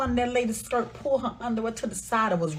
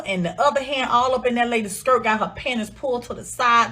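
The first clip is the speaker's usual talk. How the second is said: ordinary talk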